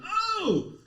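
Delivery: high startled "oh"; startled cry. Acted. Realtor opens the garage on a slumped man, startled. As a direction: cry out in mock alarm.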